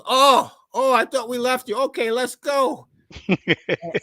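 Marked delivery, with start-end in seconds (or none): 0.0-2.9 high, relieved mock voice of the pitcher; hammed-up relief, then brisk. Pitcher relieved to find the bat boy, high mock voice. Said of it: "He goes".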